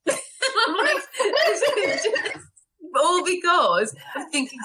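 high laughing voice; bursting into giggles. Real, not acted. Laughing about her success.

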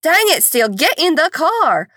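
mother scolding runaway son from car; exasperated mom. Retold or imitated. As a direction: drag it out like you're at your wits' end.

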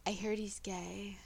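gossipy unvoiced whisper. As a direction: lean in and whisper the rumor. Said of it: "Anna leans forward and she's like".

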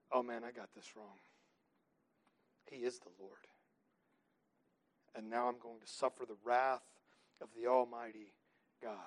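low, hushed, dread-filled confession. Pretend. Sinner at judgment realizing too late, hushed dread. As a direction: mutter it like a man caught out before God.

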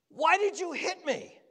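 high, loud pained question; acted indignant protest. Preacher acting punched in the belly, high indignant protest. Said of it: "I'd say".

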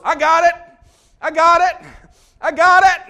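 very high, loud frantic yell; panicked mock shout. Pastor acting out Chunk as the dispenser falls, panicked mock shout. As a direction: yell it as the hammed-up klutz grabbing at something falling.

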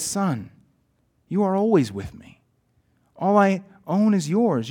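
measured, rhythmic poem recitation; poem reading. Acted. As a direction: read the rhyming verse like a storyteller. Reads his own poem aloud.

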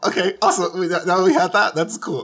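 high, loud, giddy self-mockery; starstruck rambling. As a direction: babble nervously, then hurry to get away. Acting out meeting an idol in chat.

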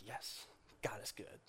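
breathy whispered yes; hushed, delighted whisper; whispered cheer. Retold or imitated. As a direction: whisper it like a private thrill. Same cheer, repeated.

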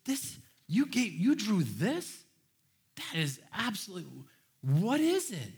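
high, slow, hammed-up wonder at a child's drawing; mock amazement. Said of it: "you're just like".

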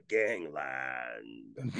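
very low, slow, grave narrator voice; deep ominous TV documentary intro. Retold or imitated. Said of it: "I would do the voices back and forth".

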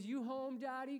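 put-on high little-boy question; Joshua. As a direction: imitate a child checking from upstairs. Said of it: "top of the stairs and goes".

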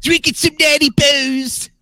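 slow, high, shouted Baltimore accent. Shown as a demonstration. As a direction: holler it like a Baltimore caricature. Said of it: "you're doing the accent all wrong".

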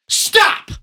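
sudden loud high shout of Stop. A narrator's reading. Father shouting at the wailing child, loud shout. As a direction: bark it at the crying child. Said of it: "he shouted".

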